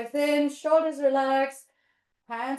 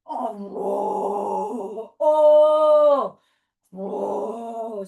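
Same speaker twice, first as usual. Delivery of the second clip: forest bear roar; 9 dB louder, much slower than usual. Pretend. Teacher becomes the forest bear, big roar. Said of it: "we found the forest bear".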